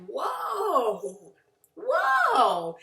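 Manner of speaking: long, high, swooping whoa; shocked whoa. Retold or imitated. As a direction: draw it out in mock alarm. Acted shock at the overreaction.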